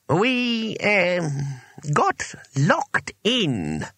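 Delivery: high, halting, very drawn out; reluctant. A narrator's reading. Wizard admitting they are locked in, reluctant. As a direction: drag out an embarrassed confession.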